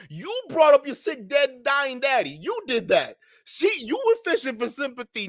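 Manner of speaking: ordinary talk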